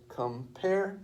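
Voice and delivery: "compare" with "pare" held on a high note; iambic stress. Shown as a demonstration. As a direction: lift and stretch the second half of "compare". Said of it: "the accent is on the second syllable".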